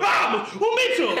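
sudden high yelp at a bug; startled shout. Real, not acted. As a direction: jump and yell as a bug appears. Bug suddenly flies at them.

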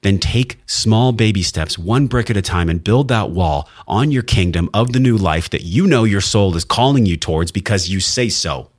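ordinary talk, nothing marked

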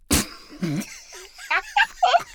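squealing laugh; high burst of laughter. Real, not acted. Host squealing with laughter at her daughter's mix-up. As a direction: crack up helplessly.

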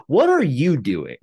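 incredulous. Retold or imitated. Mocking the bad Southern accents.

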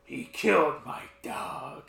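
lightly acted solemn caller, low and soft; slow down gravely toward the end; grave phone plea. Acts out grave courtesy phone call.

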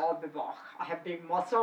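deep action-star impression; Arnold Schwarzenegger. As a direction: put on a low, accented strongman voice. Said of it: "get a deep voice and go".

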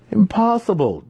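high, ringing cry of refusal; shout. A narrator's reading. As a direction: burst out in protest. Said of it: "Drizzt wanted to shout".

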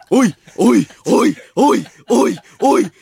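loud hammed-up cheering shout; fired-up shout. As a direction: bellow encouragement like a coach. Shouting pep at sick partner.

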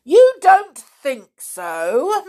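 loud, slow and scornful. A narrator's reading. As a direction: throw her words back. Bully repeats scornfully.

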